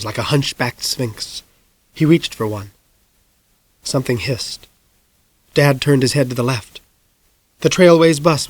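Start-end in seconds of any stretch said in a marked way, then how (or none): none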